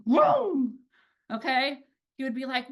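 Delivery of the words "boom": high, hyped-up retold cheer; excited coach praise. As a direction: hype the kid up like a coach.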